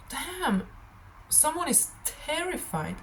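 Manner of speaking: sudden high "Damn" then a hushed line; surprised. Real, not acted. Sensing fear in the reading.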